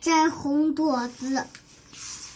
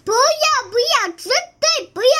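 higher, louder, fast protest; desperate refusal; 8 semitones higher, 6 dB louder, much faster than usual. Acted. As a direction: beg not to be sent away. Child refuses again. (急切地大声拒绝)

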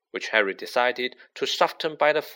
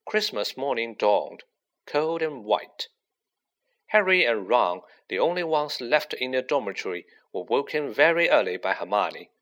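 ordinary delivery

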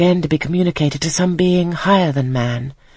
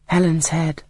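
usual speaking voice

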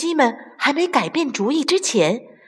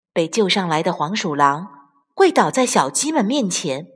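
ordinary delivery, nothing marked

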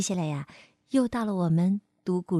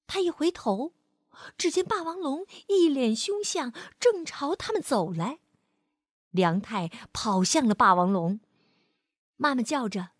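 put-on gentle storyteller reading to a child; lively read-aloud for kids; expressive bedtime-story voice; story narration; 5 semitones higher than usual. A narrator's reading.

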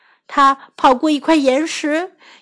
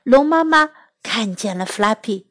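usual speaking voice

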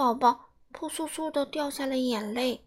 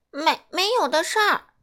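protesting; 5 semitones higher, 7 dB louder than usual. Acted. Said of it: "其他甲龙宝宝反驳道".

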